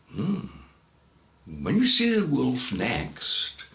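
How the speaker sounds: ordinary delivery, nothing marked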